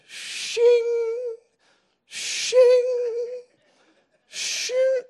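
high, ringing imitated blade scrape; sword-sharpening noise. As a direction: mimic steel on a whetstone, drawn out. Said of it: "sharpening his sword".

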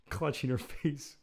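ordinary talk

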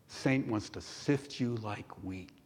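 soft, lowering, tender reassurance; loving warning. Acted. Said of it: "patient grace, his loving care".

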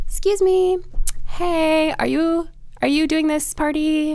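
put-on high-pitched tentative girl asking; imitated girl interrupting. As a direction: pipe up high, like someone politely butting in. Voices girl approaching the speaker.

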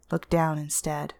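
ordinary delivery, nothing marked

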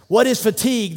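high, loud drill question; coach's yell. Retold or imitated. Coach drilling his players in August heat, yelled. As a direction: bark the question at the squad. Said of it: "he yells out".

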